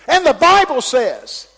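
high loud slow preacher cry; wound-up preacher voice; young preacher all wound up. Retold or imitated. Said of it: "and he go".

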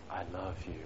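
soft, tender I love you. Shown as a demonstration. Husband to wife at a romantic dinner, tender. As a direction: say it softly with tenderness. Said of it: "with tenderness and meaning".

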